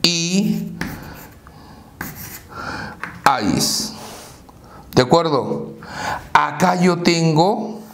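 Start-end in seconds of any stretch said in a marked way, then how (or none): 0.0-3.9 "O, I, ais" with long pauses between syllables. Shown as a demonstration. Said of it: "Separamos en sílabas". ("O, I, ais" sílaba por sílaba, con pausas largas)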